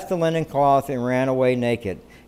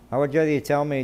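ordinary delivery

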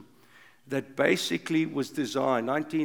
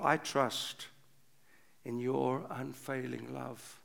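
soft trusting close; 2 semitones lower, 6 dB quieter than usual. Acted. Psalm turns to trust, softens.

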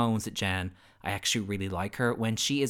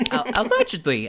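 higher, louder mock Wendy Williams; Wendy Williams impression; 10 semitones higher, 7 dB louder than usual. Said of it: "as Wendy Williams would say".

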